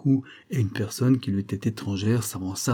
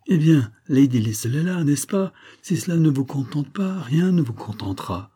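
higher-pitched exasperated scolding; 6 semitones higher, 4 dB louder than usual. Acted. Maid to the demanding sick woman, exasperated. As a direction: scold a patient who is never satisfied.

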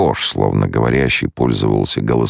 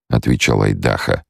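ordinary delivery, nothing marked

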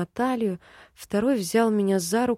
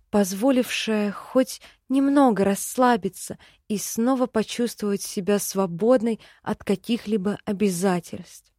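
usual speaking voice

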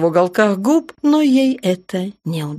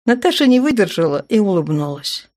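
usual speaking voice

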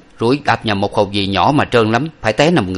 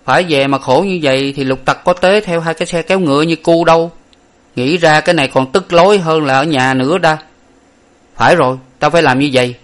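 higher, louder grumble in a character voice; grumbling boy; 6 semitones higher, 4 dB louder than usual. A narrator's reading. Lục Tặc talks himself into sneaking in, higher and louder. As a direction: scratch your head and complain to yourself. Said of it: "gãi đầu và nói".

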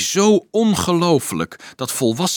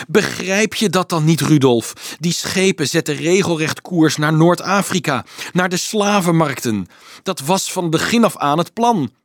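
exasperated outburst, raised and forceful; mildly agitated, pitched up; agitated revelation; 6 semitones higher, 5 dB louder than usual. A narrator's reading. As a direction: press the grim truth home, higher and louder. Monk blurts out the slavery plot.

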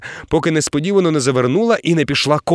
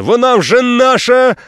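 high, loud, slow exultant cry; triumphant; 10 semitones higher, 8 dB louder, slower than usual. A narrator's reading. Captain exulting as the catch surfaces, high triumphant cry. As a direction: crow over the prize.

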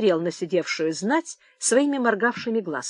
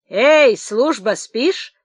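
drawn-out, louder hail, rising; calling out; 4 semitones higher, 6 dB louder, much slower than usual. A narrator's reading. Old man in the lockup calling his guard. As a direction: hail the guard through the door.

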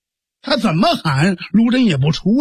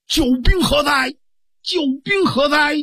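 high, ringing repeated call; calling out loudly; 7 semitones higher than usual. Acted. Guodong calls for his hidden helper, high ringing cry. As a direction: hail the hidden helper at the top of the voice. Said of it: "高声喝喊".

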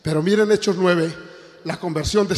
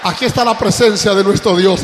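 loud, high hallelujah cry to the congregation; full-voiced pulpit shout; preaching at full force; 7 semitones higher, 11 dB louder than usual. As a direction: ring out the praise, loud and high. Preacher's opening acclamation.

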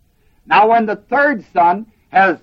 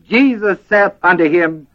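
ordinary talk, nothing marked

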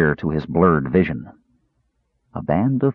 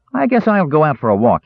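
high kender voice; light high youngster; 8 semitones higher than usual. A narrator's reading.

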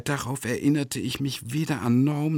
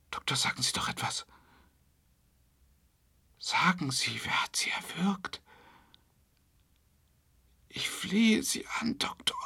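desperate pleading whisper; 10 semitones higher, 5 dB quieter than usual. Acted. Pleading with strangled doctor.

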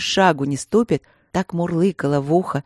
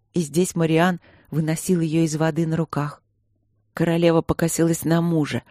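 ordinary delivery

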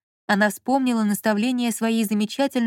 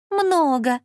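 higher-pitched teasing echo; mocking mimicry; 6 semitones higher, 3 dB louder than usual. A narrator's reading. Lola mimics her partner Lyonya, mockingly. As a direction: throw the word back, mimicking him. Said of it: "передразнила его боевая подруга".

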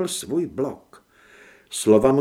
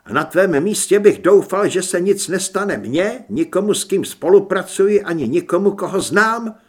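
raised, loud warning; threatening demand; 4 semitones higher, 8 dB louder than usual. A narrator's reading. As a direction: press the warning home forcefully. Son threatens his father. (hlasité, důrazné varování)

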